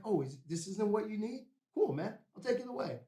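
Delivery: hammed-up fake nonchalance; mock-casual tease. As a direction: bait him, pretending to give up. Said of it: "I'm like".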